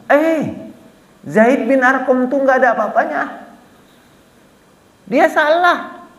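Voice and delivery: high, scornful brush-off, last words dragged out slowly; dismissive denial. Acted. Abdullah bin Ubay scoffs at Zaid to the messenger, high and scornful. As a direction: wave Zaid away as worthless.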